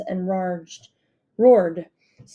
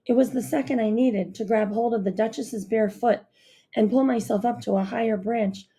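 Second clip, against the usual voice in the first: usual speaking voice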